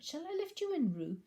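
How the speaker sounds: sweet-talking the little one; Rabbit's voice. Acted. Rabbit to Roo.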